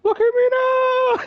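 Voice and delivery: very high, slow mocking squeak; squeaky slow taunt. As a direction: drag it out in a silly squeaky gloat.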